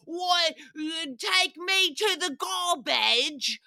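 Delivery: bad Australian accent, stretched out and mangled; failed Australian accent. Host failing at the Australian accent she can never get, botched Australian. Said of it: "that's the one accent I can never get".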